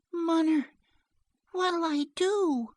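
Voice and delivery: put-on very high, drawn-out child's plea; distressed little girl. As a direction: appeal to mother as a helpless child. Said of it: "The distress of the problem".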